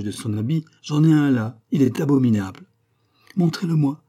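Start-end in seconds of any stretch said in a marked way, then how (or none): 3.4-4.0 higher urgent demand; eager cry. A narrator's reading. The queen demands the pamphlet with a higher, eager cry. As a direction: grab at it with your voice. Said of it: "s'écria la reine".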